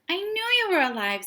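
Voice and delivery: high, loud, fast joy; bursting with relief; boy overjoyed to see his dog. A narrator's reading. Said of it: "said Tim".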